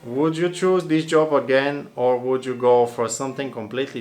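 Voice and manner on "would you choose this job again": ordinary delivery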